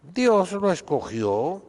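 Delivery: normal talking voice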